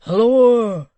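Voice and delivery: a big high hail down the passage; loud cry. Acted. Hugh hailing into the dusky passage, loud cry. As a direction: call out loud into the dark. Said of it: "cried Hugh".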